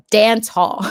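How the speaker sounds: mock loud quote. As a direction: blurt it out loud, as he did. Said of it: "said it so loud".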